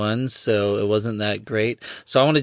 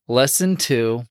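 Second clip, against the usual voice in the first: ordinary talk, nothing marked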